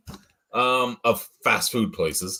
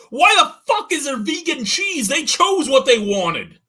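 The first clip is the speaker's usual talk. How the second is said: much higher and louder indignant cry; retold outrage; 13 semitones higher, 6 dB louder than usual. Customer arguing with sandwich staff, high loud mock indignation. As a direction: shriek it in hammed-up disbelief. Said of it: "I go".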